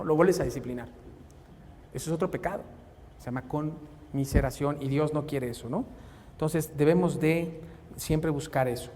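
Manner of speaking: usual speaking voice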